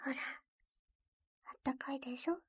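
soft unvoiced soothing whisper; ASMR whisper. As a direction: whisper comfort close to the ear. Whispered comforting roleplay.

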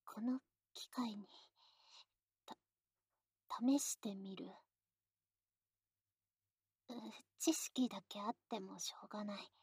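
soft, shy intimate whisper. Acted. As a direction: breathe it close to the ear.

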